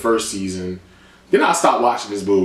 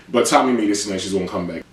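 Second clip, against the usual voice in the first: ordinary talk, nothing marked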